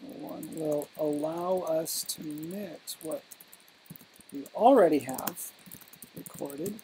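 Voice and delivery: soft and halting, long pauses mid-sentence; slow, mostly hushed, with a lift on "already"; slow dictation. Real, not acted. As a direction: dictate to yourself while writing, piece by piece. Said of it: "you're speaking too slow".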